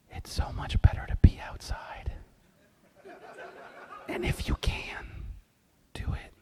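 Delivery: secretive whisper. Real, not acted. Conspiratorial advice to the boys.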